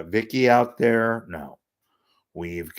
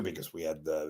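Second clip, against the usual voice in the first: usual speaking voice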